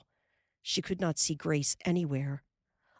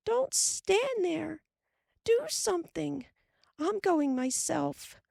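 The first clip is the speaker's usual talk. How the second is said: frantic; 13 semitones higher than usual. A narrator's reading. Carrie begs Laura to do something, frantic. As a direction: push her sister to act. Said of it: "Carrie cried".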